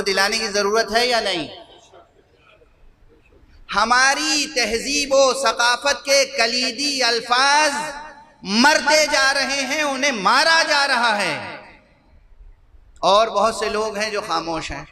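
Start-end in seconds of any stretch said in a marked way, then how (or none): 3.7-11.4 slow, high impassioned preaching. Acted. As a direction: lament to the majlis with rising fervour. Majlis address rising in fervour. (پرجوش خطابت، دھیمی اور بلند)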